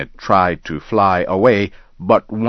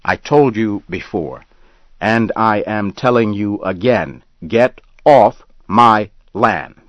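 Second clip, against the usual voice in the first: louder, slowing on get off my land; angry order; 3 semitones higher, 4 dB louder than usual. Acted. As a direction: lay down the law, each word firm. Ben orders hunter off.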